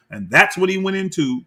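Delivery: usual speaking voice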